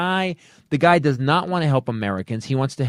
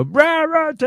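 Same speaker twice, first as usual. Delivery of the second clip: mock cheering crowd; 7 dB louder, slower than usual. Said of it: "listen and go".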